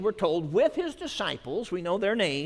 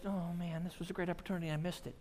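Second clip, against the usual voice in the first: regretful sigh; 4 semitones lower, 14 dB quieter, much faster than usual. Pretend. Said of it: "allowed them to just".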